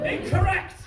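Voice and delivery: high, loud gleeful shout. Real, not acted. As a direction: bark it out triumphantly. Comic shouts at audience member.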